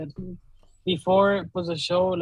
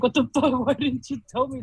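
retold incredulous protest; 5 semitones higher than usual. Retold protest at late news.

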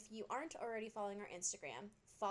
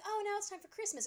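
excited students; 11 semitones higher than usual. Retold or imitated. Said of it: "they're like".

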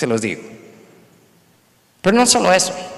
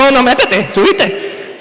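a hint of a brisk driver waving him in; lightly voiced driver hurrying him aboard; pushy taxi driver; 8 semitones higher, 3 dB louder, slower than usual.

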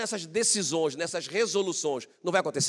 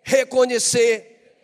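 loud, high, drawn-out shout; preacher leading a crowd chant; shouted call-out; 7 semitones higher, 11 dB louder, much slower than usual. Shown as a demonstration. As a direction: stretch the word slowly at full volume. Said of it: "Grita aí".

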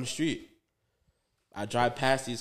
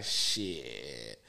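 low dismayed curse; 12 semitones lower, 6 dB quieter than usual. Retold or imitated. Cop smells the weed.

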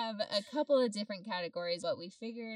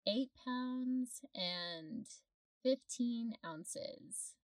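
slow, drawn-out; 2 semitones higher, much slower than usual. Real, not acted. Announcing big baby weight slowly.

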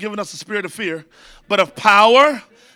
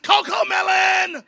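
put-on child's yell; 9 semitones higher, slower than usual. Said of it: "babies will start just yelling".

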